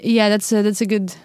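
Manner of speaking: ordinary talk, nothing marked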